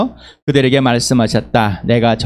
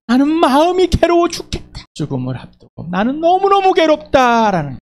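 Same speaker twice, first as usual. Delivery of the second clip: high, loud voice of a Jesus in anguish; 3 dB louder than usual. Retold or imitated. Jesus telling the disciples of his anguish, high and pained. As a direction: cry it out as one facing death. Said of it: "예수님의 심정을".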